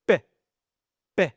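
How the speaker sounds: the sound "P" as a short crisp pop; letter sound demo. Shown as a demonstration. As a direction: model the sound "P" on its own. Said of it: "fait le son".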